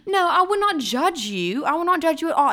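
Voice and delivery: high-pitched mock protest; London pleading. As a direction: imitate a man whining his reassurance. Imitates his pleading in the quarrel.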